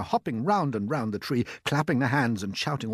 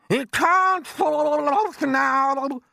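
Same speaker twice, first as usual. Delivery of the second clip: a much higher, louder put-on aunt's voice, excited; Aunt Sponge; 9 dB louder, much slower than usual. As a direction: play the greedy aunt gaping at the peach. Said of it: "Aunt Sponge shouted".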